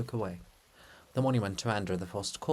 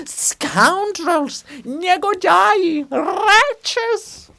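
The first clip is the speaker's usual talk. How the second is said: very high, loud, far-off shriek; distant yelling; 14 dB louder, much slower than usual. Acted. Bulgakov's monument fleeing, very high distant shrieking insults. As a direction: shriek insults as you flee. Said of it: "from far".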